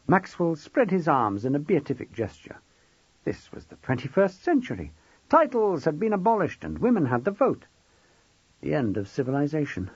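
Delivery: usual speaking voice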